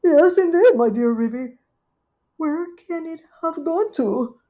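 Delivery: high, loud anxious cry. Acted. Duchess searching the pie dish, high anxious cry. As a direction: fret that it's gone.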